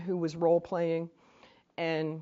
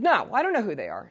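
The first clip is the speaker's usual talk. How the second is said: vicious retort; 3 semitones higher, 7 dB louder than usual. Retold or imitated. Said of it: "she was, like, vicious".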